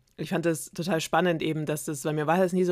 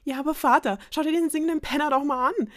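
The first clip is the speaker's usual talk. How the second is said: outraged protest; 10 semitones higher, 6 dB louder, faster than usual. Acted. Princess protesting to father.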